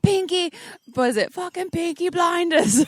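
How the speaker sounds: put-on high, loud British gangster accent; Tom Hardy impression. Showing off a British accent to the co-host, Tom Hardy impression. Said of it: "That's Tom Hardy, though".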